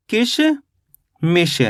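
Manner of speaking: "किशमिश" very slowly, split in two with a long pause; syllable by syllable. Shown as a demonstration. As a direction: draw out "किशमिश" as किश, then मिश. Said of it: "तो अपने मिलके बन गया ये".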